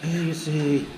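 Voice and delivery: hushed wildlife narrator voice; nature documentary. Pretend. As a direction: narrate the campers like wild animals. Mock wildlife documentary narration of campers.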